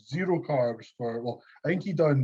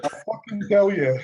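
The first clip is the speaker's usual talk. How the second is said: louder, higher mock protest; exasperated insistence; 7 semitones higher, 7 dB louder than usual. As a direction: insist forcefully to the coach. Retold exasperated reply to coach.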